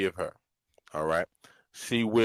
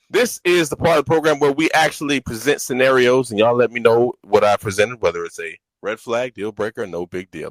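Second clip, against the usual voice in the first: show host announcement; 10 dB louder than usual. Acted. Hosts the game segment.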